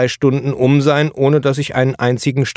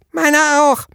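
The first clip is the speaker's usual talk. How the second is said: put-on much higher child voice stressing meiner; another child chiming in. A narrator's reading. Second child echoing, very high, meiner stressed. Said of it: "hallte es aus der Zwergenfraktion".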